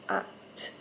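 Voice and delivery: "a" and "t" breathed, unvoiced, slow; whispered sounds. Shown as a demonstration. Teacher naming the sounds, whispered. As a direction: whisper each sound on its own. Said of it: "So we've got a p".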